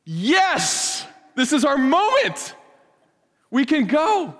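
high, elated cry of freedom; overjoyed people set free; jubilant. Retold or imitated. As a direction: celebrate like freed slaves. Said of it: "And Israel says".

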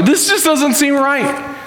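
put-on high, slow complaint, drawn out like a kid; imitated protest. Daughter coming back to parents about homework, protesting.

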